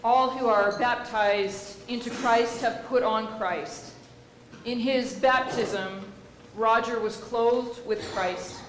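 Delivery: slow, loud, solemn intoning; solemn liturgy. Acted. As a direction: proclaim each phrase slowly to the congregation. Leads funeral liturgy.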